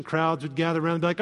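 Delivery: normal talking voice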